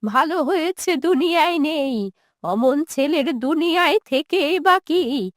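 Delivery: put-on quavering old mother, bitterly rejecting her son; wounded, resentful old woman. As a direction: refuse to hear of him, words drawn out.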